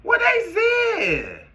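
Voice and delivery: high-pitched slow wailing "Daddy?"; mock high whiny shout. Young self yelling at his elder about devils, in a high wail. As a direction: wail like a scared child calling his dad. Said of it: "I was like".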